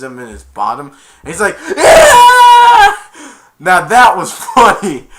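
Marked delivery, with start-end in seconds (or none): 1.8-3.0 piercing high, long mock shriek; tasered scream. Man tasered in the bottom, high mock scream. 3.6-5.1 loud, laughing delight; amused laughing. Real, not acted. Storyteller delighting in the taser scene, laughing loudly.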